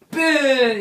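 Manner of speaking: loud, high sound-effect shout; explosive "boom". Acted. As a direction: burst out with a big boom. Acts out Zant seizing the shadows.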